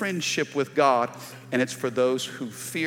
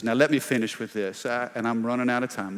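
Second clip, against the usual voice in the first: ordinary talk, nothing marked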